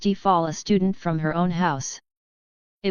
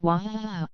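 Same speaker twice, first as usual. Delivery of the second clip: wailing cry; 4 dB quieter than usual. A narrator's reading. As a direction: burst out sobbing, a childish wail. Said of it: "She burst into tears".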